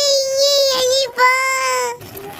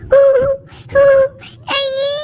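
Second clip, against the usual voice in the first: ordinary talk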